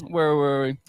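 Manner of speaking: usual speaking voice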